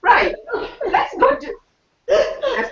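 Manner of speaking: giggling through the words; laughing. Real, not acted. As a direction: laugh while you talk, caught out. Laughing at forgetting the egg.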